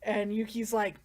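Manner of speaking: ordinary talk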